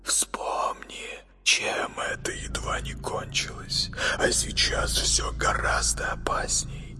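hushed, hissing whisper; breathy whisper of an uneasy boy. A narrator's reading.

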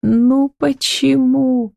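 high, drawn-out plaintive question; slow, tearful little lament; plaintive mumble. A narrator's reading. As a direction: stretch it out high, close to tears. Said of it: "пробормотала она".

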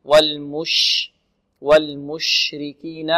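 slow والمشركين with a long spread sh; والمشركين, lingering on the sh; spreading shin. Shown as a demonstration. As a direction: let the ش of والمشركين hiss out fully. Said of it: "تفشي الشين".

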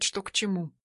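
ordinary delivery, nothing marked

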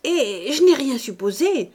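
réplique insouciante, plus haute et plus forte; insouciance sublime. A narrator's reading. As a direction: balayer le reproche avec aplomb, plus fort. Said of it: "avec une insouciance sublime". (ton d'une insouciance sublime)

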